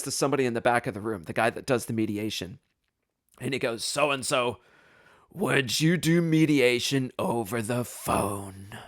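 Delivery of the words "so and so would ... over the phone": slow, drawn-out request; long-suffering judge. Retold or imitated. Judge asking mediator at the back, slow and long-suffering. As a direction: imitate a judge stretching each word with strained patience.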